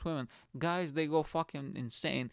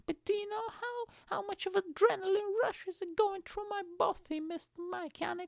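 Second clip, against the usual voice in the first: much higher, prissy put-on voice; mock thrill seeker. Climber lecturing Mister Mechanic about his thrill, much higher mock voice. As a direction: lampoon the climber's adrenaline talk. Mocking adrenaline-junkie climber.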